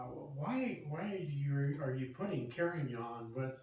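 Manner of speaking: ordinary delivery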